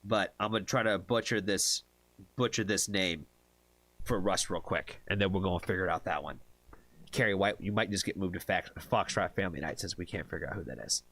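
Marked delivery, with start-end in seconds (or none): none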